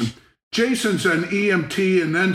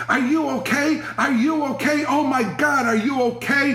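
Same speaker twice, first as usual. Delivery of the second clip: higher, urgent put-on alarm; fake panic; 6 semitones higher than usual. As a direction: pretend you think he's dying. Faking alarm at sleeper.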